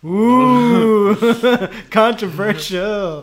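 high, loud, drawn-out teasing ooh; mock scandalised. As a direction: tease loudly, stretching the word out high. Mock reaction to casting choice.

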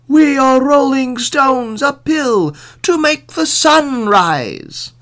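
high, loud, drawn-out voice; foolish old man. A narrator's reading. Old man explaining to the sheriff, high and drawn out. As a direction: stretch it out slowly up high.

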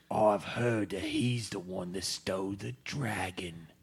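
unvoiced conspiratorial whisper. Disguised orc whispering a secret rumour to the orc leader, hushed.

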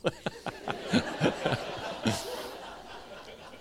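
tearful laugh. Real, not acted. Tearful laugh after emotion.

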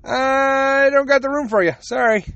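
high, slow dismissive brush-off; mocking the last doctor. Mocking the last doctor who said he had no room, high and slow. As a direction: play the lazy doctor waving him off. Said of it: "waiting forever for him to tell me".

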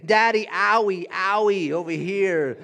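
put-on loud, high toddler whine; a toddler insisting her daddy fix an owie, loud whine; whiny toddler. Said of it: "she'll say".

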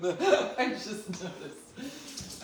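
slow, broken up by laughing; helpless laughter. Real, not acted. Noticing the shirt stain during the show, real helpless laughter. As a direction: laugh your way through it.

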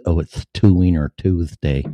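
normal talking voice

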